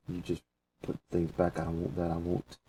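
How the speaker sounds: normal talking voice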